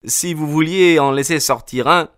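high, quick calling out to a window. A narrator's reading. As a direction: plead upward over the noise. Narrator answers the old man.

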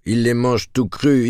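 barker call, first time building; loud carnival barker. Acted. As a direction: start the barker shout, rising. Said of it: "en imitant le bonimenteur".

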